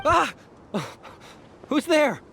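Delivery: jumpy high yelp of alarm; startled cry. Acted. Caravan leader startled by the guardian, high alarmed cry. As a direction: jump at a stranger's voice.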